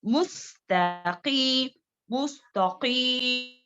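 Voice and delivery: slow मुस्तकीम with a heavy qaf. Shown as a demonstration. Teacher repeating the correct qaf for pupils. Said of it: "मोटे पढ़े जाने वाले".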